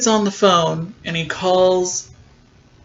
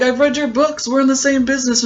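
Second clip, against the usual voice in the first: a higher, sly imitation of the producer; mock smarmy villain; 7 semitones higher, faster than usual. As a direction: play him high and slimy. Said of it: "And he's like".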